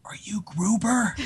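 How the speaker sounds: slow, silent whisper; barely breathed question; mouthed words. Retold or imitated. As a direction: mouth it across the bus. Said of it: "I just mouth the words".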